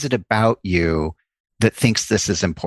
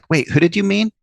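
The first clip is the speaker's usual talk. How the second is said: much higher, fast curious questions; eager interjection; 8 semitones higher, faster than usual. Shown as a demonstration. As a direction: cut in with keen curiosity. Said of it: "interjecting a question like".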